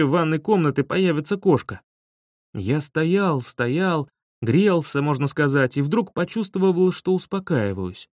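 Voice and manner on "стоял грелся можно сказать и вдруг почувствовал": ordinary delivery, nothing marked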